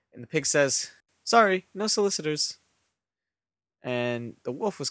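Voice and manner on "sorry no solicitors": higher-pitched polite brush-off; pig's refusal. A narrator's reading. Pig turning the wolf away at the door, polite refusal. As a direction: pipe it up through the door.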